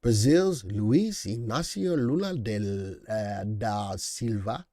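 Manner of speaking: slow, lightly put-on Spanish accent on "Luiz Inácio Lula"; mock Spanish accent. Shown as a demonstration. Stream host hamming the Brazilian president's name in a Spanish accent. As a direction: roll the name out in a half-joking Spanish accent. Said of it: "I gotta say that in my Spanish accent".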